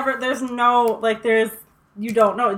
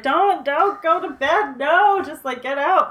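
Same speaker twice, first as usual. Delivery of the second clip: higher, louder yelling at the screen; mock urgent warning; 5 semitones higher, 5 dB louder, slower than usual. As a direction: warn them off, drawn-out and exasperated. Imagined yelling at film characters.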